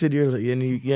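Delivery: normal talking voice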